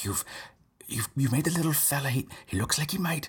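ordinary talk

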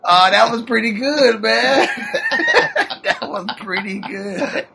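high, loud, through laughter; laughing praise. Real, not acted. Host reacts to co-host's impression, laughing hard. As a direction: crack up while saying it.

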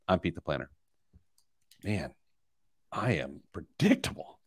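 deep rasping growl; low growling demon voice. Acted. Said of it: "Someone said they like the demon voice".

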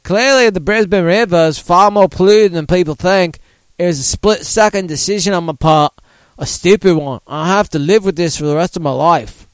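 badly faked Australian accent. Host quoting the patient's regret, in a wobbly Australian accent. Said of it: "can I do an Australian accent?".

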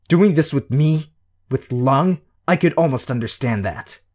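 loud, high, furious demands; outraged snarling questions; angry growl. A narrator's reading. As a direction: confront him with disbelief and rage. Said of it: "Shadowstalker growled".

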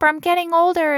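high, loud mock teenage panic; panicky teen. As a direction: squeal it like a freaked-out teen. Teen panic voiced by host.

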